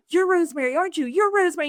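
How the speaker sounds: high, thrilled realization; excited recognition. Retold or imitated. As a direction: gasp it out like you've spotted a celebrity. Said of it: "she started jumping up and down again".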